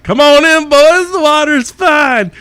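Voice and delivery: high, loud and slow, teasing the cops from the water; sing-song come-on, drawn out and cheeky; playful taunt. Retold or imitated. Drunk guy in fountain taunting cops, high and loud.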